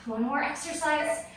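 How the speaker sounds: ordinary talk, nothing marked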